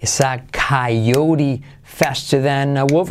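slow, drawn-out model sentence; word by word, slowly; slow repetition. Shown as a demonstration. Teacher repeating the sentence for learners, slow and careful.

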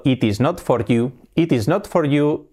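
slow, separate words "It is not for you"; careful beginner's reading of "It is not for you"; written full form. Shown as a demonstration. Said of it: "¿Qué sería escrito".

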